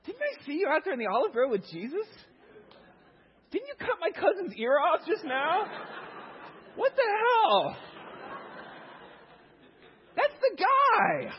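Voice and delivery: high, loud mock yell, slowing at the end; accusing shout. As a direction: point him out, hammed-up and shouting. Servant accuses Peter loudly.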